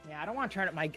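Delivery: usual speaking voice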